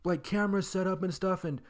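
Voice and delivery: high-pitched mock scolding; imitating the angry old guy. Angry homeowner scolding kids who trespassed, high mock scold. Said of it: "he gets out super angry".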